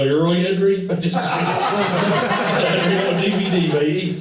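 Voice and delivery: high, loud over-eager hailing; mock pestering fan. Comic playing himself bothering a grumpy star, mock-chummy.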